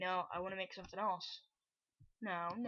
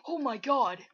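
loud, high gasped exclamation; gasp, then cry out in shock; real startled cry; 3 semitones higher, 16 dB louder than usual. Real, not acted. Gamer startled by a sudden threat, loud shocked cry.

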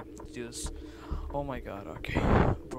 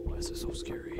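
hushed, nervous whisper; scared whisper; 9 dB quieter, faster than usual. Real, not acted. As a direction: whisper it, real nerves. Whispering nervously in horror game.

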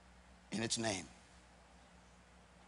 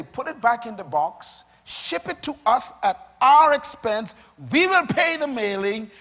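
loud, raised voice, voicing a company's guarantee; emphatic company promise; 5 semitones higher, 9 dB louder than usual. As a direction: build to a forceful pledge to the customer.

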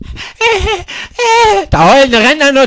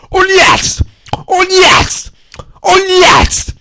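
high mock cry; 9 semitones higher, much slower than usual. Repeated cries, pitch jumps.

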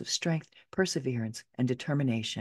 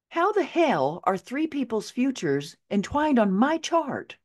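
high-pitched, incredulous question; amazed, pitch riding high; baffled disbelief; 8 semitones higher than usual. Retold or imitated. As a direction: ask it utterly dumbfounded. Baffled reaction to the chart reading.

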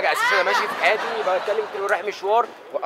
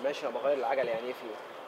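much lower and softer; hushed; 11 semitones lower, 15 dB quieter than usual. Acted. Changing the tyre near the sleeping husband, hushed. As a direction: keep it down, husband asleep. Whispering not to wake husband.

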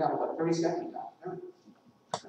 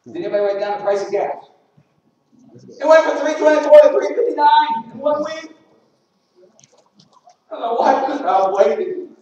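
much higher and louder, worked-up complaint; indignant rant; 11 semitones higher, 11 dB louder than usual. Real, not acted. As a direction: vent about the gas price, loud. Ranting about gas price.

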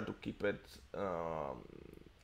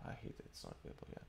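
fast, whispered grumble; whispered aside; 19 dB quieter, much faster than usual. Real, not acted. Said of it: "muttered aside".

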